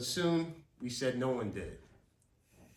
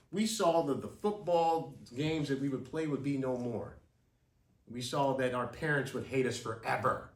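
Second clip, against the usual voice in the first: usual speaking voice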